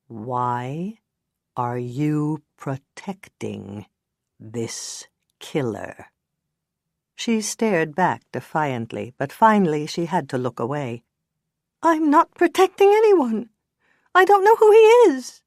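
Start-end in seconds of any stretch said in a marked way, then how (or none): 0.1-6.1 slow, soft, every word weighted; each word emphasized. Acted. Detective pressing the witness word by word. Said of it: "He emphasized each word". 11.8-15.4 much higher, louder whine; whining. Acted. Witness whining denials at the detectives. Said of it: "she whined".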